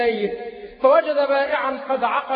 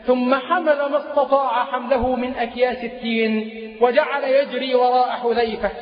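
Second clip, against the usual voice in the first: ordinary talk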